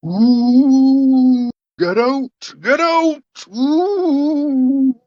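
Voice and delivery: high, wailing ghost with drawn-out oohs; haunt them out with a high hoot; hammed-up, high-pitched phantom voice; spooky ghost. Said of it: "you hear a voice saying".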